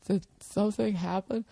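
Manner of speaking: high, breathy feigned innocence; mock innocent model. Model pulling her Speedos down, mock innocent. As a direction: play coy, pretend nothing happened. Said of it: "this look on your face like".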